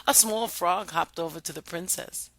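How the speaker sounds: ordinary delivery, nothing marked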